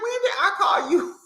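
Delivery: mock-indignant squeal breaking into laughter; high, laughing imitation of an argument; retold high-pitched protest. Acting out Wendy's protest, laughing.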